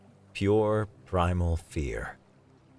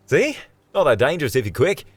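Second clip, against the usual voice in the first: high, loud, quick and chipper; cheerful guide brushing off the danger; upbeat Australian explaining eagerly; breezy Aussie David; 5 semitones higher, 5 dB louder, faster than usual. Acted. David reassures, grinning.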